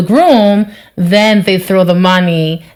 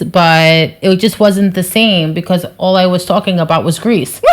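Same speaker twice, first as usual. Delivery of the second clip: ordinary talk, nothing marked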